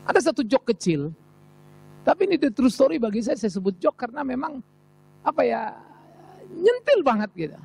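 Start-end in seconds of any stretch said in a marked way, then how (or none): none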